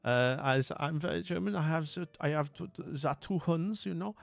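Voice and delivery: hammed-up German accent for a bit; generic German accent. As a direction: play a cartoonish German introducing herself. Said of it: "just doing my generic German accent".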